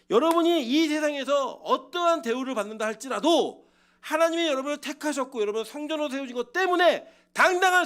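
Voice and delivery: high, loud exhortation; rising pulpit fervor; impassioned preaching. Acted. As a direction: proclaim it forcefully to the congregation. Preaches at full force.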